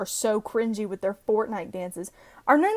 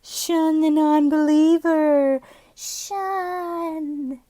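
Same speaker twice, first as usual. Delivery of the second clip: high, drawn-out cartoon chant; Charlie the Unicorn; 5 semitones higher, 5 dB louder, much slower than usual. Quoting a silly unicorn video from memory, high drawn-out cartoon chant. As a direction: stretch each word out high and loud, then let it fade. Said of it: "remember the fucking Charlie the Unicorn".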